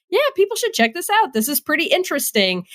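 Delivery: high-pitched mock reviewer voice; quick, high imitation of someone casually recommending a game; naive reviewer. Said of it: "telling people to go play it".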